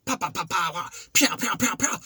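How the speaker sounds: high mock kung fu sounds; mock punch noises. Picturing himself as a fighter, mock punch sounds. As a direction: pop out imagined punches. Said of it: "I'm thinking I'm all like".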